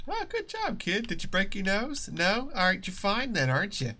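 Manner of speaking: high teasing good job; mock sarcastic praise. Joking to a hurt child in a high sarcastic voice. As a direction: tease the kid with fake praise. Mocking a clumsy kid.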